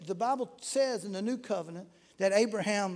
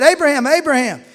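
calling out; 3 semitones higher, 11 dB louder than usual. Acted. Angel calling out to Abraham.

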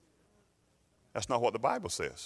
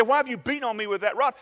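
high, quick, hurt complaint; donkey's voice; 11 semitones higher, 3 dB louder, faster than usual. Acted. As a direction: rattle off the complaint high and fast. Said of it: "She said".

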